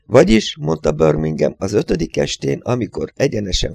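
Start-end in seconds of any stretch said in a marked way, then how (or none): none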